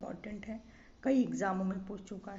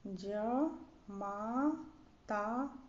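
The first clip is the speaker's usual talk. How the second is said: जमाता very slowly, softer, each syllable separate; 4 dB quieter, much slower than usual. Shown as a demonstration. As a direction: stretch जमाता as "jaa... maa... taa". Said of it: "शुद्ध है". (जमाता धीरे-धीरे, अक्षर तोड़कर)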